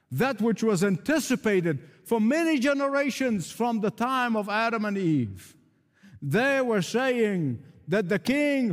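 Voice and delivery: high, rhythmic preacher's proclamation; full-force preaching. Acted. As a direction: build each phrase as a pulpit refrain.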